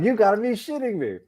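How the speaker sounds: incredulous. Retold or imitated. Reacting to the punchline.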